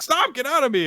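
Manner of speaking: very high, loud protest; high panicked yell. Retold or imitated. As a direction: shriek it like he's being invaded. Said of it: "when he's complaining".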